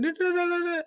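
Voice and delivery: very high rapid "da-da-da" babble; mock babble on one high pitch; imitated tongues. Wife trying to ask for her mom, imitated babbling.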